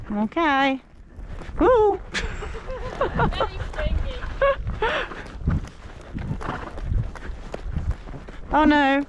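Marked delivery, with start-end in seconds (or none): 2.0-8.5 breathless high-pitched giggle; helpless giggling as the horse takes off; airy run of laughter; long high giggle. Real, not acted. Giggling nervously on the trotting horse.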